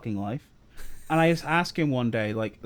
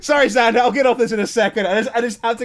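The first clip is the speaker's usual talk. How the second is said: louder and higher, still laughing; 7 semitones higher, 7 dB louder than usual. Real, not acted. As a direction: apologise through the laughter. Apologising while still laughing.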